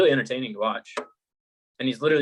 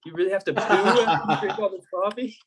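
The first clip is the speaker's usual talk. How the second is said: high-pitched mock bewilderment; retold alarm; 8 semitones higher, 3 dB louder than usual. Friend retelling a YouTuber's first coffee, mock alarm. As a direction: imitate a worried coffee first-timer, voice up high. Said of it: "He's like".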